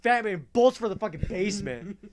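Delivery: normal talking voice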